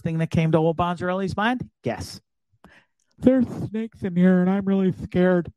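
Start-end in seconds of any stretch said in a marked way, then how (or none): 3.2-5.5 hammed-up timid confession; mock scared. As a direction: imitate your own fright, played for laughs. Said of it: "I was more scared".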